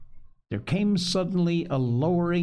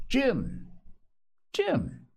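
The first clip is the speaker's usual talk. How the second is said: high, pleased repetition of a name; quite pleased; 11 semitones higher than usual. A narrator's reading. Castaway trying out the boy's name, quite pleased. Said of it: "quite pleased, apparently".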